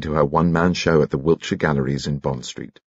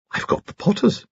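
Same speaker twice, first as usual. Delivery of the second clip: chatty, piping Victorian gentleman; high-pitched old lord. Acted. Lord chatting to painter in crowded gallery, high-pitched.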